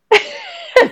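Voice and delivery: bright high laugh bursting out; a real delighted laugh; real burst of laughter. Real, not acted. Laughing at the priest's punchline.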